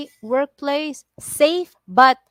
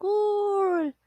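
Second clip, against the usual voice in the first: high, drawn-out "ooh"; imitated spinning noise; 10 semitones higher than usual. Driver showing how the car twirled, imitated spin sound. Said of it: "I twirled like".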